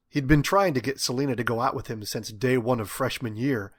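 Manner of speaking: usual speaking voice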